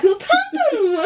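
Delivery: giggling, teasing question; laughing tease. Real, not acted. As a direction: tease her through a laugh. (gülerek takılma)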